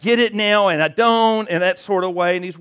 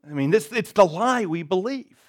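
normal talking voice

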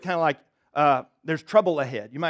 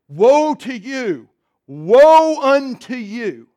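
booming, slow, much louder and higher cry of woe; loud proclamation; 6 semitones higher, 12 dB louder, much slower than usual. Retold or imitated. A stranger yells woe across the church, booming slow shout. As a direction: roar it across the church like a prophet. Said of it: "out of real loud voice".

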